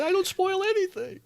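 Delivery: high-pitched whiny denial; high mock protest. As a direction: squeak out an innocent denial. Mock protest quoting Tom Holland.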